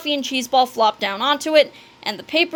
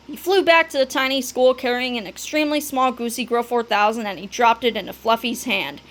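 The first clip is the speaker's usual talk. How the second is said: plain reading voice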